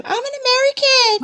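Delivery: high-pitched mock pleading cry; mock plea. Coaching a woman what to tell agents, mock pleading. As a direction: play a citizen pleading, voice way up high. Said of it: "learn how to tell them".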